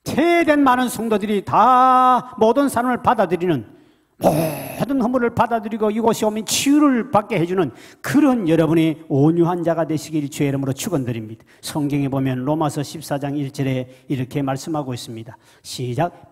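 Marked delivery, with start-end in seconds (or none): 0.0-11.4 high, loud sermon blessing; full-force preaching. Acted. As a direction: proclaim it to the congregation. (힘차게 외치는 설교 축복)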